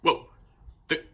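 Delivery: ordinary delivery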